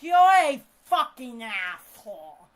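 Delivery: loud retold insult; angry retort.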